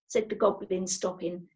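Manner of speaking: usual speaking voice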